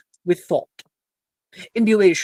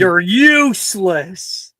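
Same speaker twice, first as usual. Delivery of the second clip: scornful; 3 semitones higher, 6 dB louder, much slower than usual. Acted. GM scolding a player in role.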